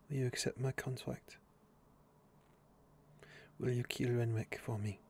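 hushed, near-whispered plea to the Witcher; soft whisper. Acted. Elodie whispers her contract into the Witcher's ear. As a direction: lean in and whisper the request. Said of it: "a whisper in your ear".

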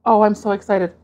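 usual speaking voice

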